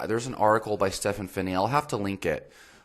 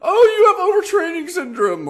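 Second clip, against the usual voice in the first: loud, very high mock voice; put-on high-pitched parody of a professional; mocking lazy professional; 8 dB louder than usual. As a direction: ridicule a glib diagnoser in a squeaky high pitch. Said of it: "I hate professionals who just".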